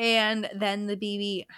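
normal talking voice

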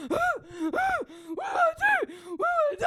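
very high, loud panicked wail; freaking out. Retold or imitated. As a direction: wail helplessly in a hammed-up panic. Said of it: "and I'm freaking".

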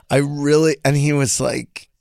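ordinary delivery

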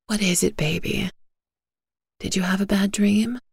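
soft comfort. Acted. Mother comforting child after nightmare.

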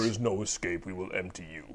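low, soft sinister menace; a hammed-up ominous threat; host teasing guest in a menacing voice; mock villain voice.

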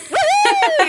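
very high "woohoo"; triumphant game-character whoop. Retold or imitated. Guest imitates her skater nailing a trick, high triumphant whoop. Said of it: "nailed this trick".

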